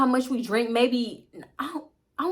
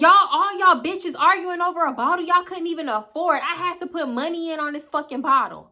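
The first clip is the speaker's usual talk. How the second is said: higher-pitched indignant rant; mock scolding; 6 semitones higher than usual. Cousin fed up with bottle argument, mock scolding. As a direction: imitate someone telling off the whole car.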